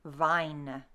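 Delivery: "vine" with a tiny schwa at the end, vine-uh; long I in "vine", then a faint uh; Middle English pronunciation. Shown as a demonstration. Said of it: "In Middle English".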